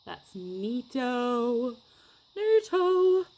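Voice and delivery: drawn-out, climbing high voice; silly high-pitched cheer; playful exclaiming. Pretend. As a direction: stretch it out and leap high. Playful exclaiming over the result.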